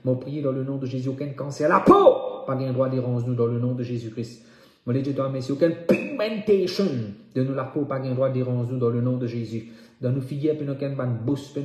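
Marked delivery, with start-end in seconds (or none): 1.7-2.4 high, loud burst of command; sudden prayer shout. Real, not acted. Preacher forbidding skin cancer, shouting fervently. 5.5-6.8 high, slow, forceful declamation; emphatic prayer cry. Real, not acted. Preacher praying against skin ailments, crying out.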